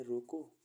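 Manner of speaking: "रोको" alone with a rise, then stop; pause after रोको. Shown as a demonstration. Teacher reading the second meaning, pause after रोको. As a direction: hang on रोको before the comma. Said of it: "फिर comma लगाया".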